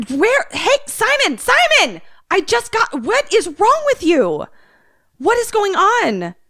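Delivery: high, loud worried wife's voice; a wife panicking at her husband's odd behavior; frantic wife. Acted. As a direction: hammer Simon with alarmed questions.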